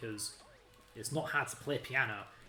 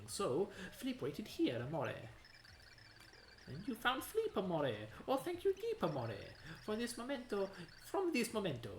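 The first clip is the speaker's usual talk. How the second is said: put-on Italian accent; 5 semitones higher than usual. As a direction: swoon over every amore in a mock Italian accent. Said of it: "It has an Italian accent".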